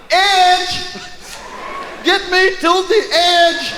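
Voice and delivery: high desperate yell for the pool edge; drowning man crying out for the edge; panicked shout. Retold or imitated. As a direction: shout it like you're going under.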